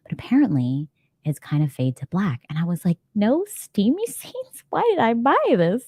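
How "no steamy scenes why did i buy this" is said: mock dismay. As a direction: play up the outraged disappointment, pitched way up. Acting out own dismay at book.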